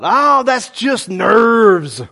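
loud, high, drawn-out scoff at oneself; dismissive. Retold or imitated. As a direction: brush it off, long and loud. Dismissing a prompting as nerves.

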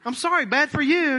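high, loud mock brush-off; mocking dismissive. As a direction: sneer it like a heartless rule keeper. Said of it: "You gotta wait".

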